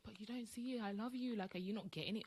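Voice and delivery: exasperated lover pleading with a partner; desperate pleading. Retold or imitated. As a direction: beg him to finally understand. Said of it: "trying to explain yourself and be like".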